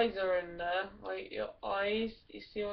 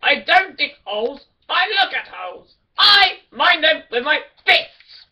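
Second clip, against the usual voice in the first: higher, much louder furious dwarf bellow; angry dwarf shout; 7 semitones higher, 15 dB louder than usual. Pretend. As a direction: roar it like a raging dwarf.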